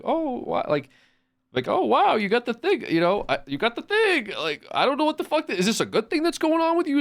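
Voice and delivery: high mock-amazed baby talk; hammed-up cooing; fake baby talk. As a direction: gush sweetly like to a toddler. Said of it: "pretend to be like".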